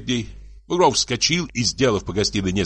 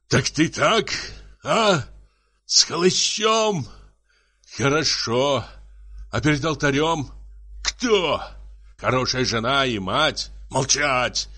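raised-pitch, indignant questioning; angry berating; 6 semitones higher than usual. Acted. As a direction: interrogate her like a jealous husband. Husband berates wife in acted quarrel.

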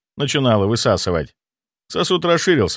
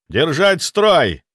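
slow loud shout of command; 6 semitones higher, 3 dB louder, much slower than usual. A narrator's reading. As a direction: bellow the order to hold. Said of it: "заорал я".

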